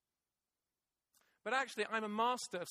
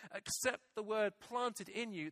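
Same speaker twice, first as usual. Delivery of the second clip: ordinary delivery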